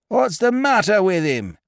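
high, loud crowd yell; gallery shout. Acted. Gallery shouting at the announcer, high and hoarse. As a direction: bellow it from the cheap seats. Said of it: "demanded the gallery hoarsely".

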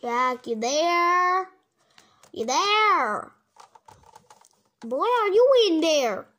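loud call into the distance. Pretend. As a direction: shout to find him. Calling out for Jack.

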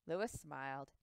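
usual speaking voice

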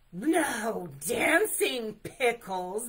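slow, huge bear roar. A narrator's reading. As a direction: roar each word, drawn out and loud. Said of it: "Bear roared".

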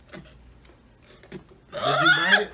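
very high, slow laughing shout; laughing squeal. Real, not acted. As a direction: squeal with glee. Teasing partner during challenge.

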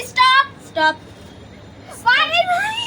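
high loud stop call; excited shout. Real, not acted. Stops the race, fails.

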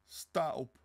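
high, loud order; urgent command. Acted. Sonja orders him to his feet, urgent command. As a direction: order him up, brooking no refusal.

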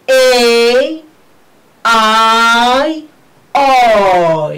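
A, I, O stretched loudly as "eyy, aay, ooy"; loud, drawn-out model A, I, O; pronunciation drill. Shown as a demonstration. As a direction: hold A, I, O long, each gliding into ee. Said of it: "We repeat the pronunciation".

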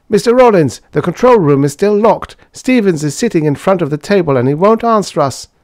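high, loud, urgent report; panicked mechanic. A narrator's reading. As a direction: burst in with frantic news for the boss. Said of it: "a wild-eyed mechanic burst in".

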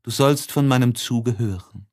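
usual speaking voice

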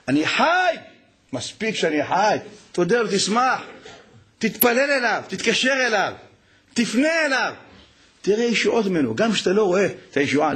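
high, impassioned preacher's call; fervent preaching. Acted. Rabbi urges listeners to turn to God, preaching fervently. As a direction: rouse the congregation.

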